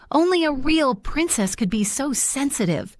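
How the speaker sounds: queen's overjoyed cry; delighted exclaim. A narrator's reading. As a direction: exclaim it as if clapping in glee. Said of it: "she exclaimed".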